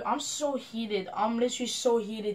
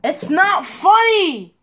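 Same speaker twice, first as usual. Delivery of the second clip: much louder, slowed furious yelling; real furious yell at a teammate who finds it funny; angry shout; 4 semitones higher, 10 dB louder than usual. Real, not acted. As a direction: hammer each word at him.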